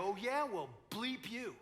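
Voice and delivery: retold curse. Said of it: "I look at her, and I go".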